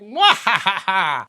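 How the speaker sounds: slow, hammy evil laugh; mad scientist cackle. Acted. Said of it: "Cawthron shows up and is like".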